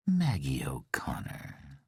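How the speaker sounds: slow, soft, whispered coo. Acted. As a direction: coo the name tenderly. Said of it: "he cooed".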